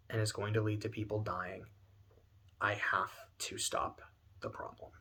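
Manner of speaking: low, hushed determination, sinking toward a whisper; subdued, reluctant decision; grim quiet resolve. Acted. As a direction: state it softly and gravely. Voicing hero's grim resolve.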